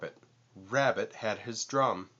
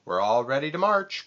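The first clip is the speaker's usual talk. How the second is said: crying out; 5 semitones higher, 5 dB louder, faster than usual. A narrator's reading. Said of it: "cried Rabbit".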